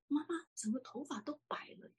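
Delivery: breathless hushed whisper of disbelief; a shocked thought, barely voiced; stunned whisper. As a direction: whisper it as if you can't believe your eyes. Stunned inner thought on seeing mother.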